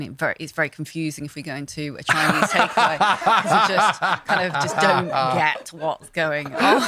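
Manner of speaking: talking through real laughter; tickled by your own anecdote; amused, laughing. Real, not acted. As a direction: let the laughter break into the story. Amused telling of takeaway confusion.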